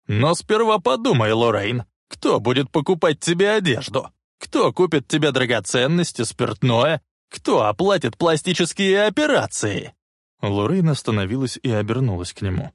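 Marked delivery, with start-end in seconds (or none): 0.1-10.0 higher, emphatic scornful questions; taunting challenge. Acted. Biff reminding Lorraine who pays, taunting challenge. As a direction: fire the questions at her scornfully.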